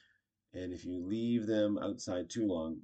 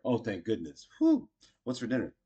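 relieved; 4 semitones higher, 8 dB louder than usual. Acted. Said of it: "oh, I'm so relieved".

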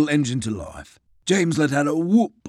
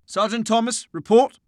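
officer command; 9 semitones higher than usual. Acted. Officer gives an order.

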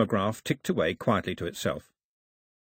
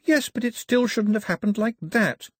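put-on higher, stubborn child's protest; insisting; 11 semitones higher than usual. Girl objecting to the sad ending, insisting. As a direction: dig in and refuse to accept it. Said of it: "the girl insisted".

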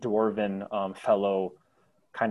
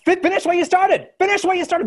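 high, loud shouted demand; shouting crowd; 12 dB louder, faster than usual. Acted. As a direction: scream the words back at them. Crowd echoes the voice.

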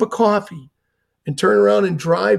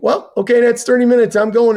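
higher and louder, declaring he's done; retold firm declaration, pitched up and louder; firm announcement; 6 semitones higher, 5 dB louder than usual. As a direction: raise the voice to announce your exit. Announcing he is leaving after thirty minutes.

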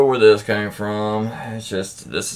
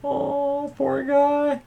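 much higher, softer, mock-sympathetic coo; mock pity; 13 semitones higher, 4 dB quieter, much slower than usual. Host pitying the boyfriend in a high mock coo. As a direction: coo over him in fake sympathy.

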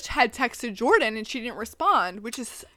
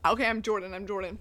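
ordinary talk

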